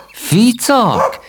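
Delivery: high, rising glad cry of a name; light up at seeing the dog; delighted recognition. Acted. Knight recognising the joyful dog, a high happy cry. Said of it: "ismerte fel az örömtáncot".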